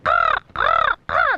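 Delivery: put-on loud, high baby crow squawks; hungry crow caws. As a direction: caw loudly like crows begging for food. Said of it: "they were ready for more".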